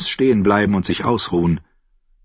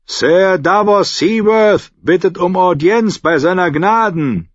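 higher and louder, hands cupped round the mouth; calling up; 11 semitones higher, 5 dB louder than usual. Acted. Davos calls up to the guard on the wall. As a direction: call up to the gate. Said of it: "legte die Hände trichterförmig an den Mund".

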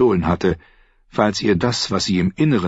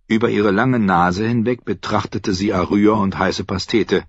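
ordinary delivery, nothing marked

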